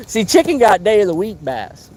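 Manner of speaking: usual speaking voice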